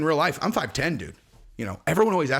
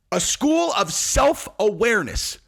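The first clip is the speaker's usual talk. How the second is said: higher, slow disbelief; incredulous repeat; 6 semitones higher, 3 dB louder, slower than usual. Retold or imitated. Comic interviewer repeating the claim, slow and incredulous. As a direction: drag it out in disbelief.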